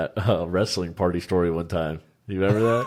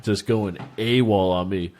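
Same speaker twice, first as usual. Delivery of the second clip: ordinary talk, nothing marked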